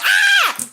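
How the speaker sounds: high loud scream of panic; inner scream. Retold or imitated. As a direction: let out a shrill inner Ah. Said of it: "on the inside, I was like".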